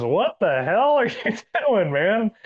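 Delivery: high-pitched, drawn-out mock dismay, breaking with a laugh; retold shocked outburst. Shop guy to the kid who shot his wing, mock shocked. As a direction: imitate an incredulous yelp, slow and laughing.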